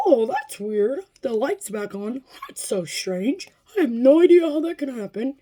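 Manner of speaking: singsong imitation with swooping pitch; baffled service man. Dodge employee puzzled by warning light, exaggerated bafflement. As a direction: put on exaggerated, clueless puzzlement.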